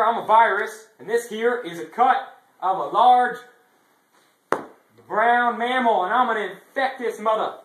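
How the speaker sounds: high, loud squeaky virus; gleeful little germ shouting; cute germ voice. Acted. Improvised skit, virus voice.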